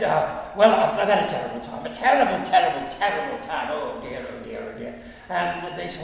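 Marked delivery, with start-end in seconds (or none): 0.6-3.7 loud, flustered comedienne complaint. Retold or imitated. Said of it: "[name] burst past and said". 3.7-5.0 low, fading catchphrase lament. Retold or imitated. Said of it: "[name] burst past and said".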